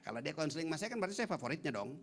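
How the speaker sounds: normal talking voice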